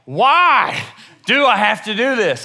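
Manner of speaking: loud, high-pitched whiny complaint; hammed-up protest; mock whining. Acted complaint after the call.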